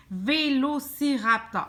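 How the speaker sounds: VÉLOCIRAPTOR slowly, each syllable marked; measured, careful VÉLOCIRAPTOR for the learner. Shown as a demonstration. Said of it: "Couper ton mot en par syllabe".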